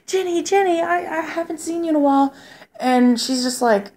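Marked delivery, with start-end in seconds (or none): 0.1-2.3 high-pitched impression of a little kid; higher, childlike retold voice; imitated kid. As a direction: mimic a kid calling out, voice lifted high. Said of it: "the kid's still saying".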